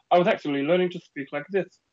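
high, articulated boxer's diction; put-on elocution voice; Chris Eubank. Shown as a demonstration. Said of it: "same speech therapist as Chris Eubank".

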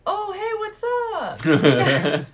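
very high, loud, slow stretched hey; drawn-out sing-song hello to a stranger; friendly stranger greeting. Retold or imitated. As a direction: mimic a cheery gamer saying hi. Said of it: "And they were like".

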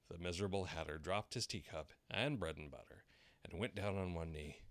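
usual speaking voice

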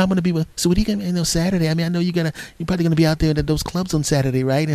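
high-pitched, casual niceness; fake nice. Girlfriend slipping in questions about Saturday, fake nice. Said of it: "she's all nice".

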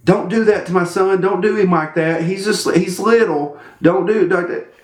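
high, mock pleading mom; fretting mother. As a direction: imitate an overprotective parent protesting. Mimics overprotective mother pleading.

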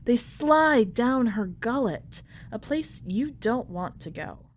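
plain reading voice

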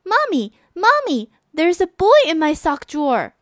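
put-on a child running to tell her mother; excited girl. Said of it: "Shelley ran downstairs and said".

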